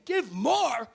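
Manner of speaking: high, shouted outrage at being asked; hammed-up frantic yelling, building in one breathless run; exasperated protest. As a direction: wail it loudly like someone pushed past their limit. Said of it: "And you're saying".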